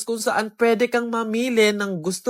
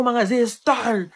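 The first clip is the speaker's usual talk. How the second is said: ordinary delivery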